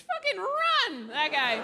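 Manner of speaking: high, slow mock yell; mock yelled warning. Comedian warning women in the audience, high mock yell. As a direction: yell RUN like a panicked warning.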